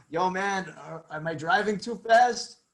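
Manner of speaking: a hint of slow stoner impersonation. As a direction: quote the comedy bit as a slow, hazy question. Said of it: "[name] and [name] is like".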